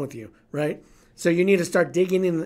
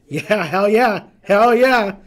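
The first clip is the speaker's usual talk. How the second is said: louder, higher hell yeah, building up; defiant yell; 4 semitones higher, 9 dB louder, much slower than usual. Retold or imitated. Answering his own dark thoughts, he yells back defiantly. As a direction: shout it back at the fear, fired up. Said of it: "I sat there going".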